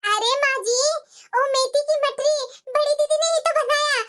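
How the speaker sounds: sweet younger bride politely giving credit; younger daughter-in-law. Acted. (छोटी बहू की हल्की सी अलग आवाज़)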